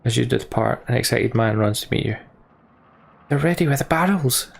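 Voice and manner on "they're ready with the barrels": raised, eager call; shouting. Acted. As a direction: call out the news excitedly. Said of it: "he shouts".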